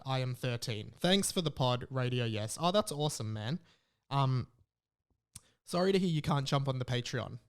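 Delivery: ordinary talk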